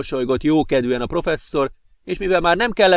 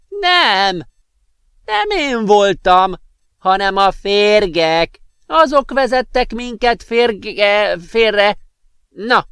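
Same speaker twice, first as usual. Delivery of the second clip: magas, lassú, akadozó öreg hang; dadogva; 8 semitones higher, 5 dB louder, much slower than usual. Acted. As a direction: kapatosan dadogó professzor. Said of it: "dadogta a professzor". (dadogó, kapatos professzor)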